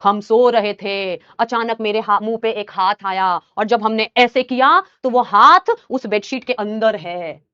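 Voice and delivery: low, very slow eerie telling; slow, low ghostly voice; possessed girl. Retold or imitated. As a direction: drag each word like a haunted girl. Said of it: "तो कहती है".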